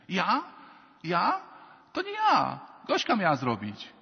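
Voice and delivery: loud, high-pitched protest of innocence; squealed mock-offended denial, bursting out; mock indignant denial. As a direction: play a child shifting blame, hammed up. Acts child's reflex denial to mother.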